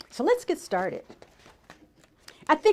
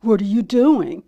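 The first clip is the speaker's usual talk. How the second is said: voiced Fox; 2 semitones lower, 9 dB louder than usual. A narrator's reading. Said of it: "said Fox".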